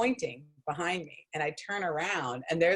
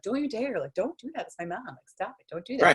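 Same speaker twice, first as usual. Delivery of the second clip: higher-pitched, half-hearted pleading protest; mock protest; 6 semitones higher than usual. Protesting at friends' teasing in story.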